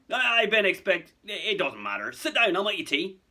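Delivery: loud, high, stammering giant; flustered Hagrid. Acted. Hagrid nervously offering his young visitors tea, flustered. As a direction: blurt it out, flustered and stumbling. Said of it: "Hagrid murmured".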